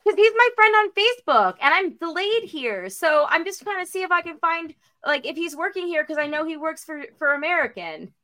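high, put-on friendly inquiry; innocent act. Stranded traveler fishing for Robert among staff, innocent act. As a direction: pitch it way up, playing harmless and casual. Pretext story told to airport staff.

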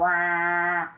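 long droning "Inception" trombone blare; imitated trailer noise. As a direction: hold a steady movie-trailer horn drone. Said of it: "when there's that noise".

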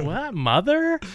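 put-on high, confused man-child voice; Buster Bluth. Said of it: "Buster Bluth".

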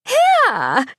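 a bright, high trilled "Here!" far above the narrator. A narrator's reading. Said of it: "Missus York trilled".

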